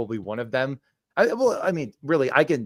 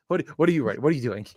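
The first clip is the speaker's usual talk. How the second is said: usual speaking voice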